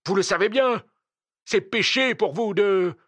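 high, loud accusation; insistent reproach. Acted. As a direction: cry it out as a reproach. Continues his shouted accusation.

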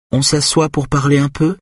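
high, evenly pitched polite question; neutral voice. A narrator's reading. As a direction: offer a talk without taking sides. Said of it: "d'une voix neutre".

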